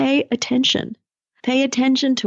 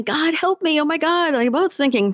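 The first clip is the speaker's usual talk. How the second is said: higher, pleading castaway voice; mock desperate prayer; 7 semitones higher than usual. Coach voicing the sinking man begging God for help. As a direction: plead with God, hammed-up panic.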